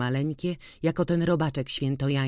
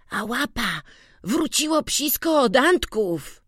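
put-on much higher, louder boyish voice; glad boy; 10 semitones higher, 4 dB louder than usual. A narrator's reading. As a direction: announce the dog's return eagerly. Witek answers gladly.

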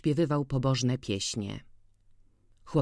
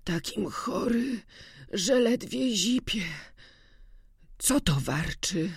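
put-on feverish old farmhand; 5 semitones higher than usual. A narrator's reading. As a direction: speak barely able to breathe. Sick Kuba complains weakly.

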